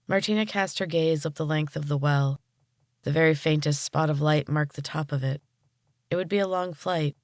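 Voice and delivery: ordinary delivery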